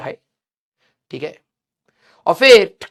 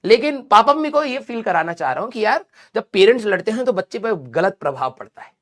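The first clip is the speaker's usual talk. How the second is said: ordinary talk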